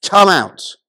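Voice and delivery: loud, commanding summons; loud calling out. A narrator's reading. Jesus calling the dead man out of the tomb, loud call. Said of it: "called out in a loud voice".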